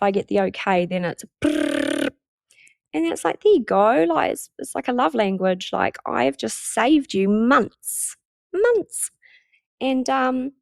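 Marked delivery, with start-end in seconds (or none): 1.4-2.1 low, slow motor brrr; rapid info-dump noise. Retold or imitated. Imitates the rush of info. 8.5-9.1 "months" jumps very high, drawn out; stress on months. Real, not acted. Emphasis on time saved.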